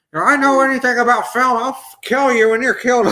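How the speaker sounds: high, loud hammed-up old man threatening; mock angry old director. George Lucas mock-threatens a stranger in a bar fight, hammed-up and high.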